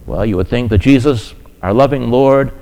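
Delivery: ordinary talk, nothing marked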